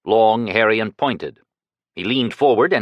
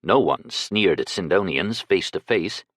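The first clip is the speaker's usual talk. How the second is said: ordinary delivery, nothing marked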